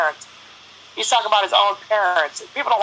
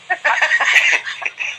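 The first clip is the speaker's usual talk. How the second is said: a short airy laugh, pitched up; breathy giggle; 8 semitones higher than usual. Real, not acted. Host laughing at own story.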